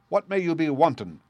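a challenging question in northern dialect; northern accent. A narrator's reading. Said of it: "asked in a northern accent".

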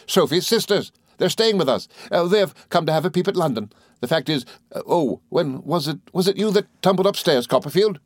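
high, flustered, happy gentleman; excitable friend. A narrator's reading. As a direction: explain hesitantly, then ask eagerly.

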